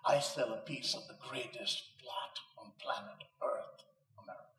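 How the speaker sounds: a hint of a guttural Romanian accent; mild Romanian-accented quote; light Romanian accent. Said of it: "in her guttural accent".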